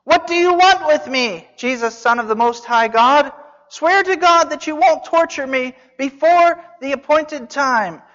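high, loud desperate cry; shouting at the top of his voice. Acted. Possessed man shouting at Jesus from his knees, loud and high. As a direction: cry out to be spared. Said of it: "he shouted at the top of his voice".

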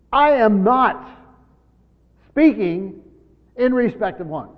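NOT and SPEAKING hit loud, each set apart by pauses; emphatic. Acted. Preacher voicing Paul's words to show the emphasis, emphatic. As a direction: punch "not" and "speaking", pausing after each. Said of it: "Those are very emphatic".

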